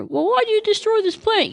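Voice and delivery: high-pitched mock indignation; angry protest. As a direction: whine the protest up high at God. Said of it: "he gets angry".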